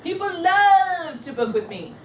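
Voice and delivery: LOVE stretched long, high and loud; slow, high, loud chant for echoing; powerful affirmation. Shown as a demonstration. Trainer leads consultants in affirmation, powerfully stretching the love. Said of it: "repeat after me with the same inflection".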